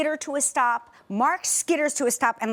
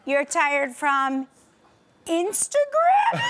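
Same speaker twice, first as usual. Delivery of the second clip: incredulous; 3 semitones higher, much slower than usual. Retold or imitated. Mom retelling her reply to her tired teen daughter, incredulous. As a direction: hit Instagram with mock outrage. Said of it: "I go".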